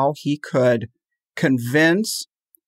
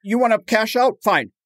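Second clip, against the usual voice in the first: mildly indignant imitation, higher-pitched; angry and indignant; 6 semitones higher than usual. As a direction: dismiss a doubter with mock indignation. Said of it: "to get angry and indignant".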